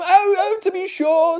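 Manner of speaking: high, loud eager assent; eager agreement. Acted. Narrator eagerly agreeing with the host, high and loud. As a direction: gush out the agreement. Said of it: "said I".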